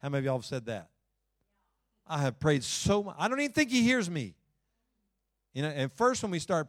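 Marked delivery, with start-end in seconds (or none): 2.0-4.4 frustrated prayer. Acted.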